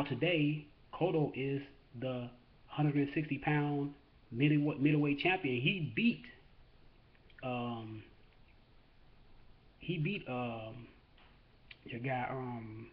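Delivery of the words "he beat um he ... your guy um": blanking on a name, slow and halting; brain freeze. Real, not acted. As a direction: stall mid-sentence, grasping for the word. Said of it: "I got a brain freeze".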